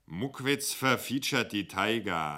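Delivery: slow, loud, self-important; slow, puffed up. Acted. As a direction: deliver the punchline slowly and proudly. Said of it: "sagte langsam".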